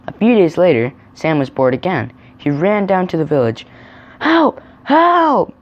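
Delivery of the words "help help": very high, loud acted yells; crying for help. A narrator's reading. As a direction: yell for help in a piercingly high voice. Boy falsely crying for help.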